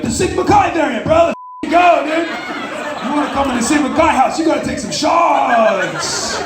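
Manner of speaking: hammed-up frat bro yell. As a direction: hype it up like a drunk frat brother. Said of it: "You'd be like".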